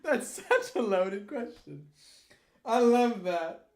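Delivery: slow words through laughter; laughing. Real, not acted. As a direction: laugh while you talk. Laughing at question.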